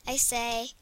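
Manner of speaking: normal talking voice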